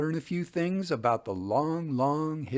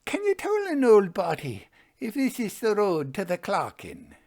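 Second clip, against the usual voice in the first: put-on high, quavering old woman's voice; lost old beggar woman asking the way; 8 semitones higher than usual. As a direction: plead like a frail old Scottish beggar. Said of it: "I heard a voice saying".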